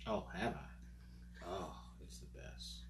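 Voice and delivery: usual speaking voice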